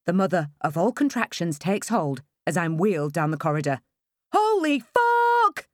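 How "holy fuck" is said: very high, slow, loud scream; woman screaming through a huge contraction. A narrator's reading. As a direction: let the scream tear out of you. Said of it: "I hear myself scream".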